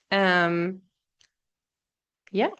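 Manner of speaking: talking through a brain freeze; slowed by a sudden brain freeze. Real, not acted. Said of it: "I'm getting a brain freeze".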